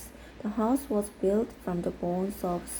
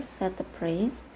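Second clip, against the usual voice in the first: ordinary delivery, nothing marked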